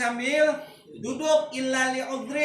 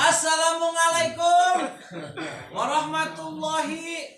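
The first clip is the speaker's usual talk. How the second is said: laughing mock Javanese Arabic greeting; Javanese accent; 6 semitones higher than usual. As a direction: ham up a Javanese speaker reading Arabic. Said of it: "Kalau orang Jawa ngalamin".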